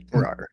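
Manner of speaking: breathy unvoiced rawr; a hushed animal growl; whispered growl. Tiger summoned, growls at kids.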